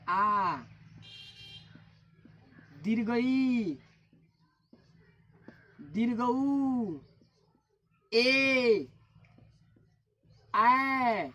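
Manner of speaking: आ, दीर्घ ई, दीर्घ ऊ, ए, ऐ each held, then falling; sustained, separate आ, ई, ऊ, ए, ऐ; long vowel demo. Shown as a demonstration. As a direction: stretch each vowel from आ to ऐ, pausing between. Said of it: "जिसका उच्चारण में दुगुना स्वर लगता है".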